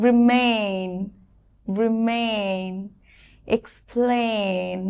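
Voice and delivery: Remain and explain said slowly and softly with a long এই; slow it right down so they can copy; teacher drilling slowly for the class to repeat; slow model words. Shown as a demonstration. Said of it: "আস্তে করে বলছি".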